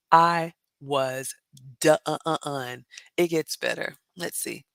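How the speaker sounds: ordinary delivery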